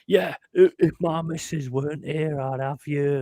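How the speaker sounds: lightly voiced little man saving face; backing-down bravado. Retelling a little guy backing off, mild mock bravado. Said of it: "and he was going".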